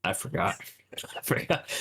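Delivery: whispered with a laugh; sheepish laughing whisper; embarrassed whisper. Real, not acted. You explain it.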